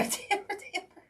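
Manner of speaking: laughing through the name, then squeaking it very high; laughing call. Real, not acted. Host laughing at a viewer's comment, calling the name high.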